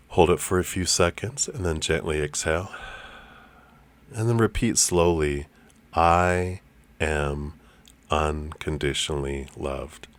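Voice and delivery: soft, calm meditation guide; guided meditation. Acted. Leads a breathing meditation.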